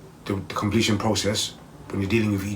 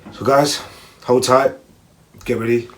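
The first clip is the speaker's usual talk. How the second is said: hyped call-out; 4 semitones higher, 8 dB louder than usual. Real, not acted. Hyping viewers before meditation.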